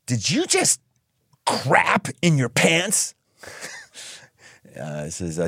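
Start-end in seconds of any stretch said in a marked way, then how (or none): 0.0-3.1 a hint of high mother voice, slow; lightly voiced mom. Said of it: "She says".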